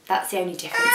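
ordinary delivery

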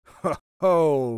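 loud, hearty acted "ho, ho"; roaring laugh. A narrator's reading. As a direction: roar it out as the amused dairyman. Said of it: "roared the dairyman".

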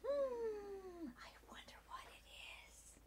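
hushed whisper. Acted. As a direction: whisper it like a secret. Builds suspense for kids.

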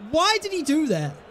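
ordinary talk

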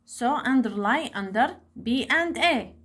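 ordinary talk, nothing marked

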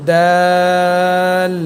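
দাল with a hard dental d and very long vowel; tongue tip on tooth roots, sustained দাল; letter articulation demo. Shown as a demonstration. Teacher demonstrating the letter dal, long held দাল. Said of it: "দাল হরফটি উচ্চারণ করবেন".

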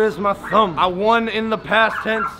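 metal over teeth; slight lisp from grill; speaking with grills in. Real, not acted. Seen 4: Grill clearly on teeth before; glints on open mouth during words.

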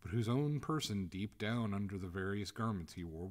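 plain reading voice

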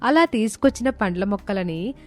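plain reading voice

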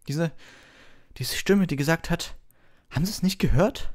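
high, loud, pressing questions; you can't believe he missed it; urgent insisting. A narrator's reading. Harry urges Lockhart he must have heard it.

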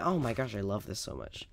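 ordinary talk

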